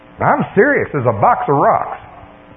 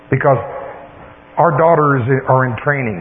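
usual speaking voice

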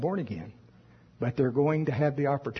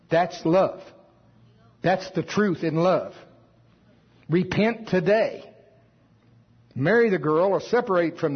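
fiery preaching; 3 semitones higher than usual. Acted. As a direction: press the warning home to the congregation. Pastor warns congregation, rising intensity.

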